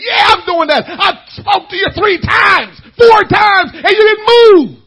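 loud, high enraged yelling; furious father shout. Retold or imitated. Father finally exploding at a son who won't listen, shouting. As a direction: let the wrath come out at full volume.

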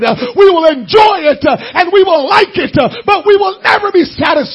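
high, loud, climbing preacher's cry; preaching at full force. Real, not acted. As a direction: drive it home to the church.

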